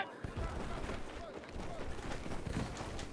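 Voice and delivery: long breathy sigh of frustration; heavy sigh. Real, not acted. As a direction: let out a weary sigh. Frustrated after a rough play.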